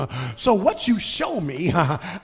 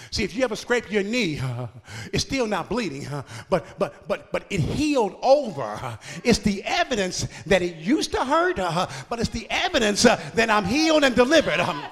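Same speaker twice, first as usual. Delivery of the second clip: normal talking voice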